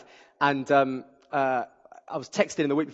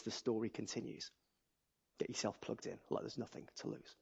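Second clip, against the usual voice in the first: rhythmic poetry performance, rising and dropping to a hush; dramatic spoken word delivery; spoken word poem; 3 semitones lower than usual. Acted. Spoken word poem before sermon.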